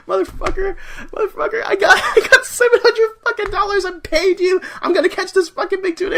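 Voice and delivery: high, loud hammed-up tirade; mock outrage. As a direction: yell like an outraged tourist, half laughing. Acting out furious tourists, laughing.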